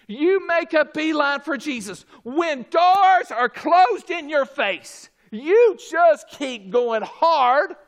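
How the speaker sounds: loud, high, drawn-out exhortation; forceful preaching. Acted. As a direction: urge the congregation on at full voice.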